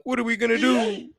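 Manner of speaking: high, mock-scared whine; mock panic. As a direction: ham up the panic of the scared crowd. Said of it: "Now everybody's all scared and panicking, going like".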